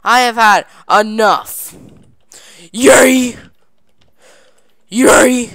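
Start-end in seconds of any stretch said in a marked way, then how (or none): 2.7-5.5 loud, high repeated yay; excited cheering. Real, not acted. Gamer cheering each jump, loud high yays. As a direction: cheer every landing.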